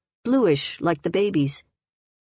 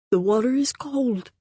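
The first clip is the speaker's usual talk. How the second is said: high, small pleading voice; fearful; 7 semitones higher than usual. A narrator's reading. Frightened mother dreading the cold river, high and pleading. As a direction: lift it high, fragile and worried. Weeping mother fears the crossing.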